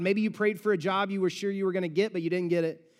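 ordinary delivery

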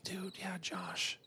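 hushed gossip whisper; whispered secret. Retold or imitated. Kid gossiping in sixth grade.

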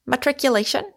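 cheerily innocent question; bright. A narrator's reading. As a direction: ask it brightly with a lift in pitch. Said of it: "asked Moon brightly".

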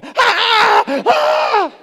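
two high, loud screams of pure panic; panicked inner scream. Retold or imitated. Businessman screaming in his head as the plane goes down, loud panicked cry. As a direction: scream it like a terrified passenger. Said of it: "he's going".

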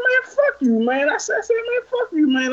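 very high-pitched mock outrage; heated retold cursing. Him cursing the driver in traffic, high and heated. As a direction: squeal the curse, reenacting the row. Retold quarrel, his repeated curse.

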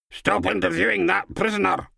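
mock the pompous boss; unflattering mock impression of a superior; mocking impersonation. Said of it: "less than flattering impersonation".

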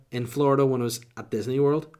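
usual speaking voice

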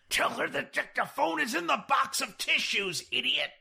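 high, forceful stage whisper; angry hiss. A narrator's reading. George coaching Jack from the washroom, angry hiss. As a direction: hiss it furiously at a bungling partner. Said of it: "Angrily from the washroom".

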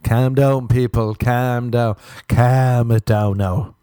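mock calming. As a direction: settle the crowd down, teasing. Telling listeners to relax jokingly.

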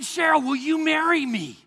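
loud, high, ardent plea; impassioned, ringing proposal; passionate proposal. Retold or imitated. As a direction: propose with all your heart, pitched high and loud. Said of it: "you could also hear the passion".

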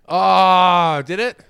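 high, loud mock surprise; a burst of put-on shock. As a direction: act startled, hamming it up.

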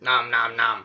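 loud nom nom chewing noise; chewing sound. Shown as a demonstration. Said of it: "Like what, like".

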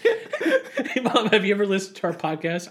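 high laughing question; mock son voice. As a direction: ask mom in a teasing voice. Acted question to mom.

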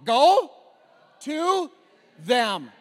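shouted even harder, word by word. Shown as a demonstration. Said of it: "Say it louder".

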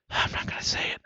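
unvoiced whisper. Real, not acted. Shopper whispering to himself, whispered. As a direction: breathe it with no voice.

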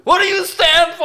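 shrill, loud mocking demand; taunting villain yelling high. Retold movie villain taunt.

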